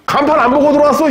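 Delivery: 높고 빠르게 쏘아붙이는 핀잔; 간판도 안 봤냐고 높게, 빠르게 톡 쏘듯; 퉁명스러운 경상도 주인. Retold or imitated. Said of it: "경상도 집에 갔더니". (경상도 식당 주인이 손님에게 핀잔, 높고 빠르게 쏘아붙임)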